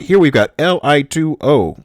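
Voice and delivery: ordinary talk, nothing marked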